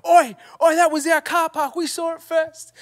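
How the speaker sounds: mock yelling lads. Youth lads giving them grief from the car, high mock yell. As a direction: call out like a rowdy lad. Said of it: "they're like".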